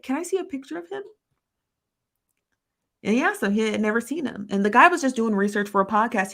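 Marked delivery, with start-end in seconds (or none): 0.0-1.0 higher, quick quoting of the guy; lightly voiced asking guy; mild quote voice. Podcast host asks to see a photo, lightly voiced. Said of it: "The guy said".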